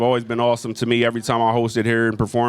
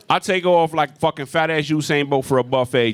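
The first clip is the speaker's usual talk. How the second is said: ordinary talk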